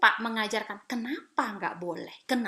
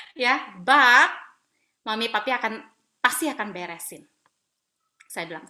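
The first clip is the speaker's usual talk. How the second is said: ordinary talk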